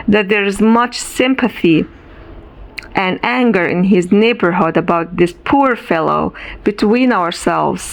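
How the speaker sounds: plain reading voice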